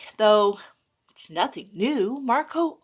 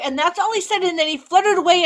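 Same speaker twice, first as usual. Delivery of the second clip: usual speaking voice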